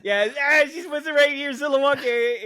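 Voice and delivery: high, loud mock [name] way of saying Zilwaukee; mock [name] accent. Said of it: "in an [name] accent".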